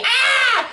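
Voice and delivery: short, high-pitched mock scream; imitated scream. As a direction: scream like a horror-movie victim, not full force. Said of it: "starts screaming".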